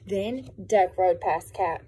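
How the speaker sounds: ordinary talk, nothing marked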